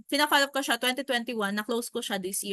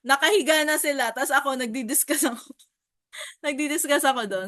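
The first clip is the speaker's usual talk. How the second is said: laughing through the story, higher; 3 semitones higher, 4 dB louder than usual. Real, not acted. Agent laughing that the client's wife fell asleep. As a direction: giggle between the words at the absurd scene. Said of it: "So, nakakatawa lang".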